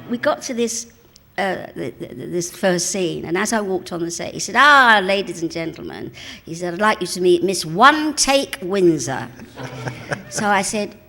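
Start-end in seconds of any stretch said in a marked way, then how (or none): 4.6-6.1 loud mock master-of-ceremonies flourish; grand announcement. Director presenting the actress to the film set, grandly. 6.7-9.3 slow, loud mock introduction; grand announcement. Director introducing the cheeky actress on set, grandly.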